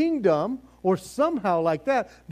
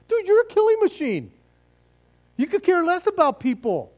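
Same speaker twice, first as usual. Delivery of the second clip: high, loud, fast mock accusation; incredulous outburst; 9 semitones higher, 4 dB louder than usual. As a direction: burst out at him in high-pitched disbelief. Retorting to Joab in acted dialogue.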